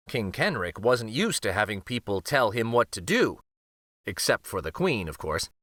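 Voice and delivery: usual speaking voice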